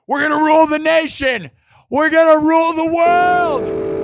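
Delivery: rally the chat; hype shout. Real, not acted. Streamer rallying chat around the song, shouting high.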